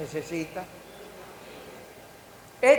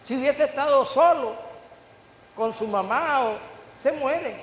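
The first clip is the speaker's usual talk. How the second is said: emphatic, higher and louder preaching; 5 semitones higher, 5 dB louder than usual. Acted. Preacher repeats the warning to the congregation, loud and emphatic. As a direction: drive it home, with a dramatic pause before the last words.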